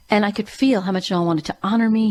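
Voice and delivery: ordinary talk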